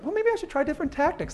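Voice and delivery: high-pitched musing voice; naive self-mockery. Mocking his own naive past thinking.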